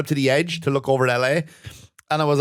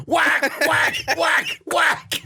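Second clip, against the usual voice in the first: rising higher with each whack; mock whacking sounds; 13 semitones higher, much slower than usual. Mimicking hitting in game.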